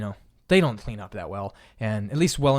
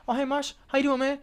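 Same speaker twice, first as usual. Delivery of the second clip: much higher, friendly greeting; cheerful coworker; 13 semitones higher than usual. Retold or imitated. Dishwasher greeting him at shift end, cheerful. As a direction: sound all friendly again. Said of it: "he's like".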